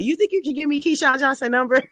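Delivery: high, fast, excited fan voice; imitating a starstruck fan. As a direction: gush it out like a starstruck kid. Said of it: "I used to be like".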